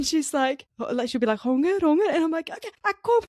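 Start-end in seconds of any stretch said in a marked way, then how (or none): 1.4-2.1 high, loud toddler whine, imitated; pitched-up pestering child; demanding toddler. 2.5-3.2 high-pitched mock exasperation; hammed-up high reply to a nagging child; harried mum. Acted frustrated mum answering toddler.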